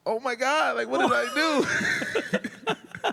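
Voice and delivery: high, slow dismayed wail; mock panic. KG walks past at breakfast, high comic panic. Panic after KG ignores him.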